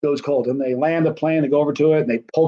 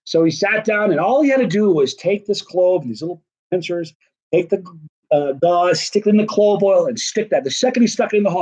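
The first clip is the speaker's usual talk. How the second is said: ordinary talk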